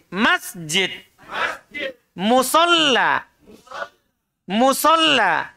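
slow, careful Musolla, held syllables; slow model word. Shown as a demonstration. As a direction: drill Musolla slowly for the class. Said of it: "Lihat, dengar, ulang".

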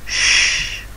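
long hushed sh, no voice; sh held unvoiced; sh sound. Shown as a demonstration. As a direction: blow a soft sh. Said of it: "S H".

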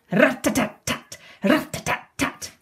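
crisp high percussive rattle; rat-a-tat drumming. Acted. Eleanor drumming with driftwood, crisp rat-a-tat. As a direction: tap it out like sticks on a tin. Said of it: "makes a new sound".

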